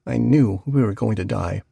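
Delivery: plain reading voice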